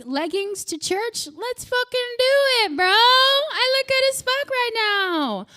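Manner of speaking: high, excited; hyped-up bro. Retold or imitated. Women hyped about leggings, high excited bro talk. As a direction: pump yourself up. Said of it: "everybody was like".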